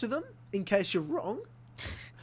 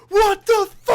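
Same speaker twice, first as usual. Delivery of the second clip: much higher, loud yelled disbelief; shocked shout; 13 semitones higher, 15 dB louder, slower than usual. Retold or imitated. Friends discover both from Orange, shouted shock. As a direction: yell it in total shock.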